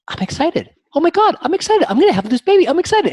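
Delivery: high, loud burst of joyful realization; acting out a patient's giddy excitement; excited. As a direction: exclaim it like thrilling news just dawning on you. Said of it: "Sounds like excitement".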